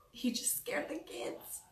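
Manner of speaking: soft unvoiced aside; whispering. Real, not acted. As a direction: whisper it off to the side. Aside during her intro.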